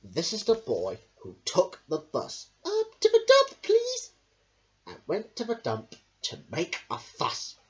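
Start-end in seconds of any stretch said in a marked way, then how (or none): 2.7-4.1 put-on very high, loud child voice; squeaky eager request; high boy voice. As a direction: ask eagerly as a little boy. Boy asks bus driver.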